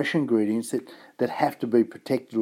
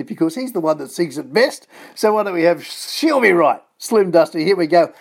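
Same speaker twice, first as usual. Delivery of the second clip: louder, higher, building radio-host excitement; hyped-up song intro; 7 semitones higher, 8 dB louder than usual. Real, not acted. Radio host introducing a [name] song, hyped up. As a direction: pump up the listeners for the next track.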